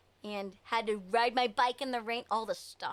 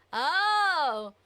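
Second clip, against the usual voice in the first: loud sudden oh of realization; surprised; 5 semitones higher, 8 dB louder than usual. Real, not acted. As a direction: burst out, louder and higher.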